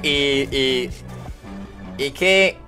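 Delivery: usual speaking voice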